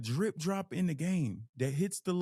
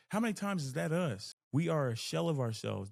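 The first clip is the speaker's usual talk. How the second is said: normal talking voice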